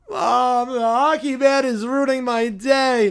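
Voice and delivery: drawn-out tearful whine; whiny tattling. Acted. Child calling Mom about hockey man, tearful whine. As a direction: whinge for a parent's help.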